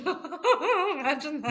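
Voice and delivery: slow, high words through laughter and tears; half laughing, half crying. Real, not acted. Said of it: "I cry when I say it".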